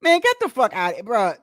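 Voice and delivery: ordinary talk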